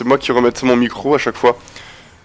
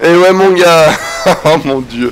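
laughing triumph; 2 semitones higher, slower than usual. Real, not acted. Streamer laughing at his win over the villain. Laughing after the game kill.

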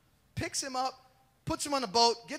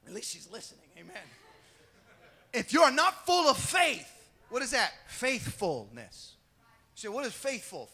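normal talking voice

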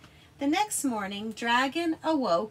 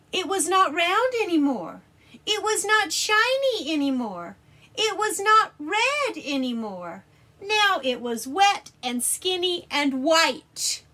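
high, loud dramatic telling; 7 semitones higher, 9 dB louder than usual. Acted. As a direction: build the shock. Dramatic reveal read out.

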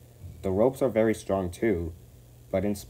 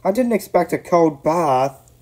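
high, loud startled complaint; shivering shock; 10 semitones higher, 10 dB louder than usual. A narrator's reading. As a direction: cry it out, shocked by cold water. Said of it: "he shivered".

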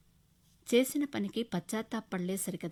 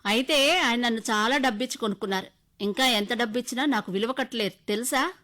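bitter taunt; 4 semitones higher, 9 dB louder than usual. Acted. Husband taunting in quarrel.

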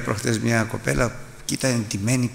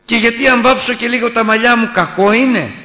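higher, louder mock of a vain young woman; preacher mimicking a worldly girl, pitched up; 12 semitones higher, 7 dB louder than usual. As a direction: play the coy girl defending her makeup. Vain woman quoted mockingly.

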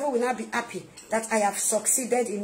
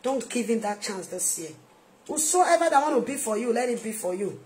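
usual speaking voice

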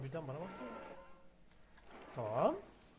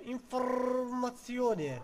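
mock Italian; 4 semitones higher, 5 dB louder, much slower than usual.

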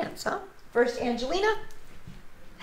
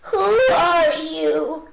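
slow, high, loud wary question; a little frightened; 6 semitones higher, 10 dB louder, much slower than usual. A narrator's reading. Alexander meets an unknown mouse, a little frightened. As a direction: stretch it out in alarm. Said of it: "a little frightened".

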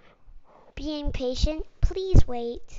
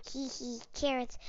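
quiet giggly voice; 12 dB quieter than usual. Acted. Rabbit replies softly.

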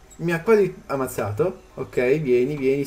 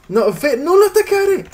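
panicked shout; 12 semitones higher, 6 dB louder, slower than usual. Real, not acted. Pet attacking, shouted command.

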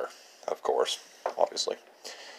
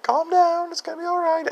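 imitating mom, very high and soothing; a high mock-mom voice calming a crying kid. Mom calms her crying little boy at the bog, high soothing voice. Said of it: "Mom's like".

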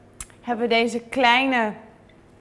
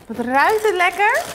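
excited; 9 semitones higher, 6 dB louder than usual. Real, not acted. Smells fresh brownie.

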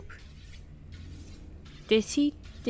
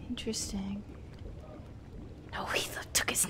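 soft unvoiced whisper; hushed sneaky whisper; 2 semitones lower, 13 dB quieter than usual. Real, not acted.

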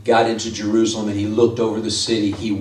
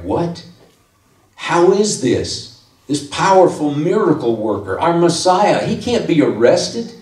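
mock disbelief; 6 semitones higher than usual. Crowd voiced in disbelief.

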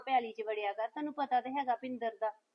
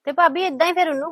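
much louder, arguing back hard; raised voice in a family row; loud scolding; 4 semitones higher, 15 dB louder, faster than usual. Acted. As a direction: shout the old quarrel at her. Heated family argument.

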